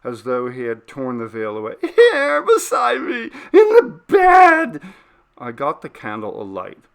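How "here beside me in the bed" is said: much higher and louder, sobbing shriek; a panicked man crying out with terror; terrified crying scream. Acted. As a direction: scream in horror at what is beside him. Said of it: "he screamed sharply".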